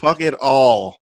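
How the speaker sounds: slow, drawn-out don't-care line; joking fan shrugging off risk, drawn out; mock reckless bravado. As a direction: say it like throwing caution away, slowly.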